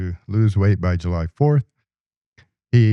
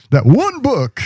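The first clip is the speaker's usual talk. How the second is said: high, loud lounge lizard parody; hammed-up lounge lizard exclamation; 11 semitones higher, 5 dB louder than usual. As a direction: belt it like a cheesy lounge act, laughing. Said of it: "with our lounge lizard voice".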